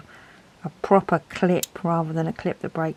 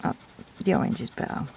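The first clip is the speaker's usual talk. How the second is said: softly whispered comparison; 3 semitones lower, 7 dB quieter, faster than usual. Real, not acted. Reviewer comparing pens while swatching, whispered. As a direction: murmur it to yourself, no voice.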